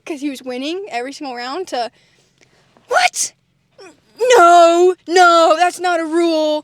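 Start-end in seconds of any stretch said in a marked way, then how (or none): 2.9-6.6 much higher, louder, near-crying protest; upset protest. Retold or imitated. Dad losing at Uno, high, loud near-crying protest. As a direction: wail that it's not fair. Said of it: "Really, really upset".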